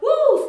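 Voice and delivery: loud high whoop; excited woo. Real, not acted. Hype shout while dancing.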